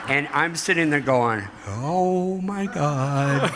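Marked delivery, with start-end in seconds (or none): 1.7-3.5 very slow, drawn-out gasp of wonder with a laugh; awestruck. Retold or imitated. Recalling first sight of the girl, slow awestruck wonder. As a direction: let it melt out, dazzled.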